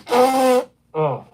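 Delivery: nose blow, sniffly; blowing nose mid-sentence. Real, not acted. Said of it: "I apologize for me blowing my nose".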